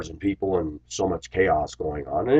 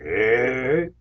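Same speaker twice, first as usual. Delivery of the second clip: Fat Albert impression; 5 semitones higher than usual. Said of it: "a Fat Albert".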